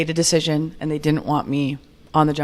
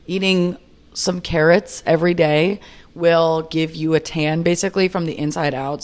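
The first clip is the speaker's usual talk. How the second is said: normal talking voice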